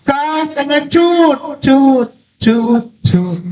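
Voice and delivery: high holler with the last syllable bouncing; imitated echo. Mountain throws the insult back at the child, imitated echo. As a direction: repeat the tail like a mountain echo. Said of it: "Sana dijawab".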